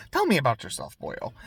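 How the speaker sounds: attempted Irish lilt, ending on a soft "boyo"; Irish accent. Villain asks a boy about himself, attempted Irish accent. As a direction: try an Irish accent on a casual invitation. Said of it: "I'm trying to do an Irish accent".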